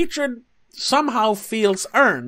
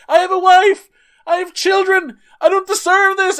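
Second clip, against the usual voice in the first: much higher and louder, desperate protest; pleading outburst; 11 semitones higher, 6 dB louder than usual. Retold or imitated. Chauffeur yelling at the car that hit him, desperate. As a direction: shout it like a man at his limit. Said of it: "He's like".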